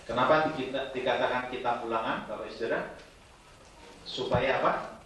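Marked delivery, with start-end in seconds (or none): none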